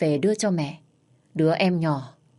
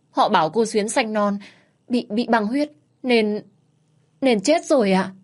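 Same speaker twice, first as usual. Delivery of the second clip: higher, halting tearful report; tearful stammer; 5 semitones higher, 3 dB louder than usual. A narrator's reading. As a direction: stumble over the words through tears. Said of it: "nước mắt ngắn giàn giụa".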